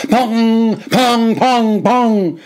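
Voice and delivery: high, loud ringing pongs; helmet-banging noise. A narrator's reading. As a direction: sound out the hard knocks on a helmet. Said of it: "went my helmet".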